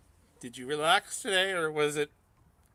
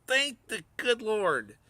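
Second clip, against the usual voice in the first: higher, slow mock-thankful cheer; relieved parent; 7 semitones higher, 3 dB louder, much slower than usual. Host acts out a parent glad the cartoon is banned, slow mock relief. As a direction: drag out no SpongeBob like a grateful parent. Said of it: "how many of you are like".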